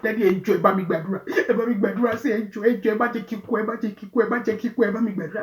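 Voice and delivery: loud, pleading Yoruba prayer request; frightened pleading. Retold or imitated. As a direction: beg for prayers in panic. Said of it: "my sister was shaking".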